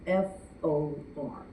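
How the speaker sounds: ordinary talk, nothing marked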